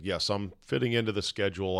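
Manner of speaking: normal talking voice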